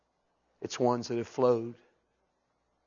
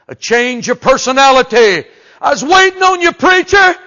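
impassioned high cry; 11 semitones higher, 16 dB louder than usual. Acted. God's voice, rising cry.

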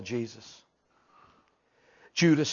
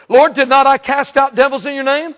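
pleading preacher; 11 semitones higher, 13 dB louder than usual. Acted.

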